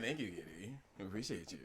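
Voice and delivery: low, quiet villain voice, imitating; mock-sinister deep villain thanks; slow theatrical villain menace. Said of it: "With his villainous voice".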